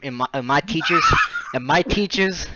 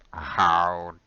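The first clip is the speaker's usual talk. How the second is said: much lower, very slow menacing drawl; Snape impression; 10 semitones lower, 5 dB quieter, much slower than usual. Professor confronting students caught out, low slow drawl. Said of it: "let me do my best Snape".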